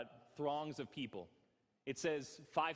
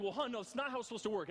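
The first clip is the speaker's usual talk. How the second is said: fast, higher, mock-angry protest to his wife; hammed-up frustrated husband, rushing; retold growing anger; 9 semitones higher, faster than usual. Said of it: "And I'm getting angry".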